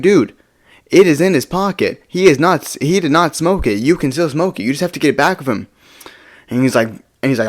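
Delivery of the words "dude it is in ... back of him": exasperated retold scolding, pitched up; mad. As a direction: reason heatedly with a friend, higher and emphatic. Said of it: "obviously I'm mad".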